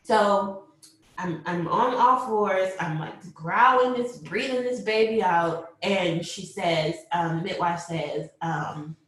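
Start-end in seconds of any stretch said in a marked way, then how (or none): none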